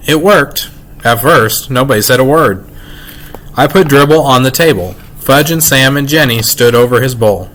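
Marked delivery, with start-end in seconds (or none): none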